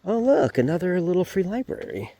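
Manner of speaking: high delighted discovery; light up at the find; pleasantly surprised. Real, not acted. Spots a library on walk.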